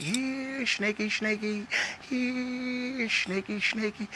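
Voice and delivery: high sing-song call of "snaky, snaky"; man searching the house for his pet snake, sing-song high call. Retold or imitated. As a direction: coax the hidden snake out, high and lilting.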